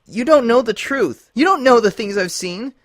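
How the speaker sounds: high, loud anguished cry, voice breaking; high, loud cracked voice; voice cracking. A narrator's reading. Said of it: "Twilight's voice cracked".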